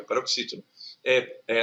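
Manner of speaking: normal talking voice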